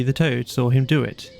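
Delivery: ordinary delivery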